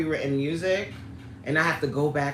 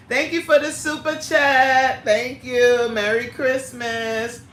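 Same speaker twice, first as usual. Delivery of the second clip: high, loud, happy sing-song; delighted thanks; 6 semitones higher, 5 dB louder, slower than usual. Real, not acted. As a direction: gush gratitude, stretching the final greeting. Thanks viewer for a super chat.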